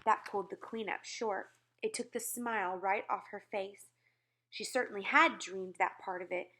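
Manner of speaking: plain reading voice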